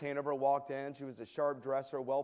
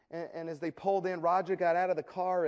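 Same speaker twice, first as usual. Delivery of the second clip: ordinary talk, nothing marked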